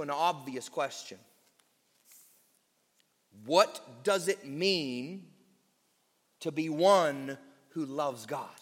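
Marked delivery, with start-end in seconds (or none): none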